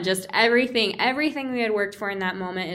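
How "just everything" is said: ordinary delivery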